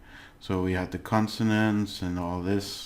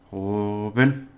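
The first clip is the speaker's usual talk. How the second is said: "Joven" with a long first syllable; stressed syllable; 4 dB louder than usual. Shown as a demonstration. Teacher shows learners where stress falls, syllable drawn out. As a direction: draw out "Jo" in "Joven". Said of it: "the pronunciation is stress, where you do it".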